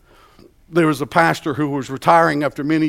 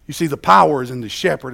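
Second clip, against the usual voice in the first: ordinary delivery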